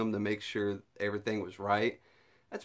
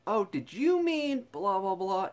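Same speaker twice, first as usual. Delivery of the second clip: barista correcting; 9 semitones higher than usual. Retold or imitated. Barista voiced correcting order.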